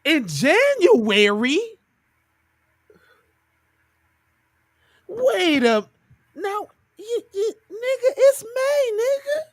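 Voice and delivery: high-pitched, stretched disbelief; incredulous. Real, not acted. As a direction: question it in rising, squeaky disbelief. Disbelief at the reported January date.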